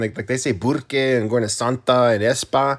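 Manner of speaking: put-on tough northern New Mexico accent. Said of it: "northern New Mexico accent you're putting on".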